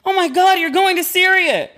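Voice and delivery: high, loud alarmed mom voice; panicked mother. Retold or imitated. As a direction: squeal it like a terrified mother. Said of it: "she was like".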